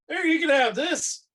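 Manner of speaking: high, loud, impulsive offer; hearty offer. Acted. Fighter hands his sword over, high hearty offer.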